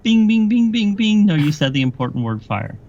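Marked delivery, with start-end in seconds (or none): 0.0-1.2 high, loud rapid dinging; ding it out like a game-show bell; mock winning bell. Mimics a quiz bell for right answer.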